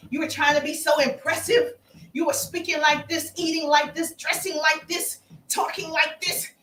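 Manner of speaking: usual speaking voice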